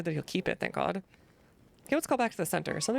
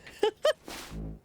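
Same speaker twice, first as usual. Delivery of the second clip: high squeaky giggle. Real, not acted. Squeaky laugh.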